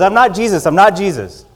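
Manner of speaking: high, loud acted panic; panicked denial. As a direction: blurt it like a scared patient backing off. Patient backs away in panic.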